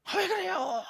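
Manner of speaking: very high-pitched cry of protest; acted-out wail of grievance; resentful complaint. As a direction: complain up at God, exasperated. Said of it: "불평하고 원망해".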